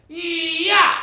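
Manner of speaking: high, sharp martial arts kiai; imitating a karate master's battle cry; karate shout. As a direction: bark it out as you chop a board. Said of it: "and he goes".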